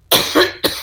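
sharp breathy cough; coughing fit. Real, not acted. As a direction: cough through the list. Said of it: "me ha dado un ataque de tos".

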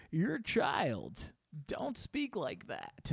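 high, slow mock reproach; mock scolding ladies. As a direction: imitate women putting a kid down, drawn out. Ladies mocking his put-on voice.

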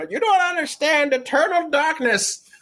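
grumpy grandpa. Retold or imitated. Grandpa lecturing grandkids, imitated.

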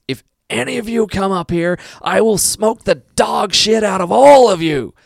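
high, loud full-throated threat; screaming drill sergeant. Retold or imitated. Rival sergeant screams threats at raiders storming the stairs, furious scream. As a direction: bellow it, stretching out the last words slowly.

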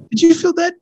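very high, astonished question; amazed question. Retold or imitated. Father asks his daughter after praying, amazed. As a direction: leap up in pitch with wonder.

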